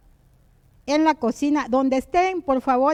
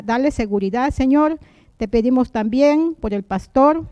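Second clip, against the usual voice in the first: fervent spoken prayer, a bit louder; earnest prayer; 5 dB louder than usual. Acted. Host leads the listeners in prayer, earnest prayer voice. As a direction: pray aloud on behalf of everyone.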